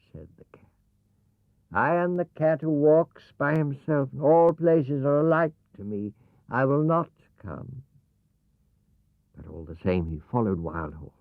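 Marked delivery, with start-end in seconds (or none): 1.7-7.8 the haughty cat, pitched higher; Cat refusing, aloof and sure of himself; proud cat. Acted. Cat answers Wild Horse, haughty higher cat voice.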